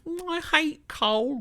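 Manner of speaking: very high, slow and loud falsetto whine; made-up character voice. Acted. The streamer voicing a made-up child who hates coal, in a slow high falsetto. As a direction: stretch it out in a silly high squeak. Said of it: "those people that I just made up".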